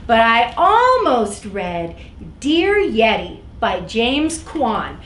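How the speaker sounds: ordinary delivery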